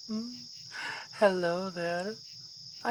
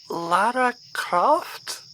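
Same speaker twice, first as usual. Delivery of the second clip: slow, louder, mocking disbelief at the name; scoffing as she reads the impostor's name tag; incredulous; 3 semitones higher, 9 dB louder, slower than usual. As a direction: drag out the absurd name. Reads an obviously fake name tag.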